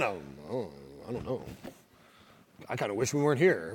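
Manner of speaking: very low, hushed mock mumble; sheepish imagined reply. Imagining followers answering the scribes, sheepish and hushed. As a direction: put on a small, reluctant voice under the breath.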